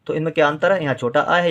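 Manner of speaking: usual speaking voice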